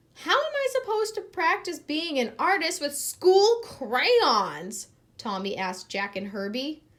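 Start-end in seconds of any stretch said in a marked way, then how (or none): 0.1-4.9 put-on higher, louder protesting boy; indignant child. A narrator's reading. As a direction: whine it like an upset kid.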